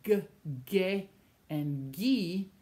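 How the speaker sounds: g, ge, gi said "guh, geh, ghee"; hard G. Shown as a demonstration. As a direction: keep g, ge, gi hard, separated slowly. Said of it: "we have to add an H".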